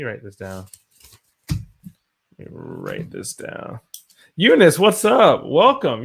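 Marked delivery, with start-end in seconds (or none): 1.5-3.8 a deep, slowed-down repeat of the words. Acted. Repeats phrase slow, low voice. 4.3-6.0 loud, high, happy shout-out; excited greeting. Real, not acted. Greeting viewer loudly on stream.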